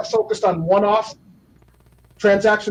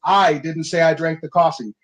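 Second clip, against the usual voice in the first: "I" stressed at the start, slightly lower voice; 4 semitones lower than usual. Shown as a demonstration. Coach demoing seven meanings, emphasis on "I". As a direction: open the line by hitting "I". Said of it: "you can literally say it seven different ways".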